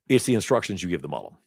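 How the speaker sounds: fast, rattled off; quick recap. Shown as a demonstration. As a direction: run through it quickly as a summary. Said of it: "I would say".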